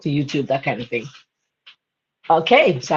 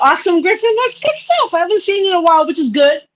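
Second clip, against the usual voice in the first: excited, high greeting; 9 semitones higher than usual. Real, not acted. Greeting friend in chat.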